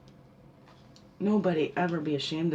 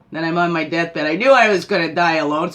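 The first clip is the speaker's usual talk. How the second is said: louder hammed-up dying regret; mock deathbed lament; 9 dB louder than usual. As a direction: play the dying regret big and loud. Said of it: "doing the opposite like we just talked about".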